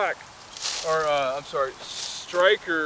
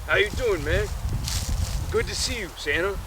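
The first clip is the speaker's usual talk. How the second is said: lightly voiced polar bear, raised pitch; toned-down, higher-pitched bear hello; polar bear, second attempt; 5 semitones higher than usual. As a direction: greet an old pal as a polar bear. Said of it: "how did he talk?".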